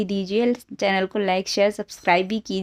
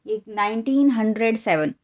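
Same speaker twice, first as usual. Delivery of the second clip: usual speaking voice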